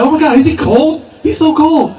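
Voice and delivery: very high-pitched gushing onlookers; mock gushing. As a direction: squeal it like an overexcited admirer, hammed up. Acts out onlookers gushing over him.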